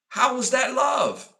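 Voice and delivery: high indignant shout, slowed down; outraged shout. Real, not acted. Shouting at the viewers over love. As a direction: yell the question in disbelief. Rhetorical outburst about love.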